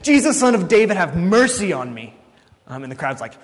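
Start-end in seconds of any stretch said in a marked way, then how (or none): 0.0-2.1 raised voice, a restrained cry for help; acted scream. Preacher as blind Bartimaeus calling to Jesus passing by, crying out. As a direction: call out over a crowd, higher and louder at first. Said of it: "and screams out".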